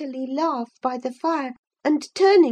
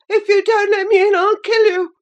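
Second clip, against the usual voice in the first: louder, fast, higher frantic shriek; frantic boy shrieking at the door; 5 semitones higher, 5 dB louder than usual. A narrator's reading. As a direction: scream the threat faster. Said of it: "he rather shrieked than said".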